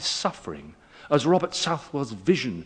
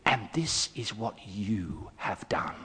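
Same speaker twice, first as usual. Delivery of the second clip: low, slow and quiet, a solemn verdict; a touch of the stern Jesuit pronouncing guilt; grave accusation; 7 semitones lower, 8 dB quieter, slower than usual. Retold or imitated. Jesuit preacher telling the boys, grave and slow. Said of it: "he said".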